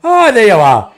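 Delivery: bright, loud cheerful greeting from a hospital bed, pitched high; imitating his cheerful mother; cheerful mum. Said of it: "you know, cheerful".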